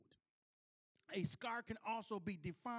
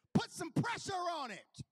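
high, louder insistent woman's voice; high raised voice ordering him; mock bossy command; 10 semitones higher, 7 dB louder than usual. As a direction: bark the order like a worried wife. Retold wife telling him to press.

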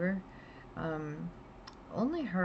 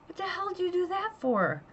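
much higher, outraged squeal of a question; indignant yell; 14 semitones higher, 3 dB louder than usual. Retold or imitated. Owner yelling at her biting dragon, high indignant outrage. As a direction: demand why she bit you. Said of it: "And I'm like".

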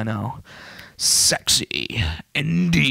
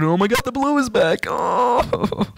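excited; 9 semitones higher than usual. Real, not acted. Screen turns blue.

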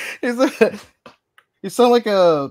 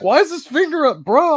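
much higher, louder disbelief; high incredulous; 7 dB louder than usual. Retold or imitated. Baffled shopper reacting to kid's raised finger, high and loud. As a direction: squeak it out in disbelief. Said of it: "I was like".

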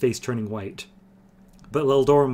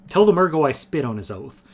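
louder, higher, spitting the words out; hot defiance; 5 semitones higher, 7 dB louder than usual. A narrator's reading. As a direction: hurl defiance at the Murgo. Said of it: "Leldorin said hotly".

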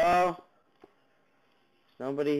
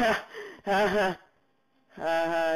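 gloating laugh; 5 semitones higher than usual. Real, not acted. Gloating at mob below.

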